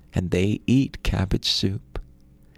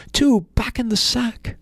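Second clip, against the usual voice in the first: much higher, strained panicked stammer; frightened stammer; 14 semitones higher, 4 dB louder than usual. Acted. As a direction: blurt it out in a panic under the blows. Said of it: "Ivan the Poor stammered".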